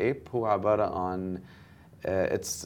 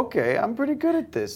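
much higher, pleased with himself; self-satisfied; 4 dB louder, faster than usual. Retold or imitated. Telling host how he realised his marketing skill, much higher and smug. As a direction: tell yourself you're good at this, amused. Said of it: "so I'm like".

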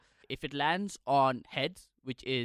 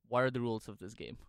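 normal talking voice

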